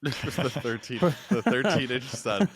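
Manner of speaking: real laughter through the words; laughing. Real, not acted. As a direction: repeat it while cracking up. Cracks up at the joke.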